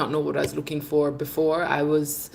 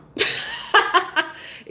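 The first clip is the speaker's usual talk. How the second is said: breathy laugh; 13 semitones higher than usual. Real, not acted. After planning a third visit.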